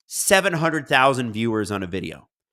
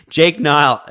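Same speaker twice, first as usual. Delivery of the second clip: normal talking voice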